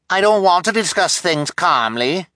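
high, loud indignant outcry; crying out. A narrator's reading. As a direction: protest loudly at the top of the range. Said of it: "cried the woman with dyed hair".

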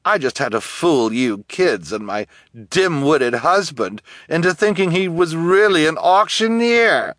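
high, theatrical villainess boast; boastful villainess. A narrator's reading. Guardian boasting how she fooled everyone, theatrical and smug. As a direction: show off like a smashing actress.

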